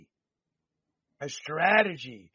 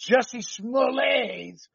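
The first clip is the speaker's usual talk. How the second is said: Smollett's said the French way as smo-LAY, slow and drawn out; a playful fake French Smollett's, sounding the name smo-LAY; light mock French accent on Smollett's, said as smo-LAY; 7 semitones higher, 4 dB louder, much slower than usual. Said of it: "throw the French accent in there".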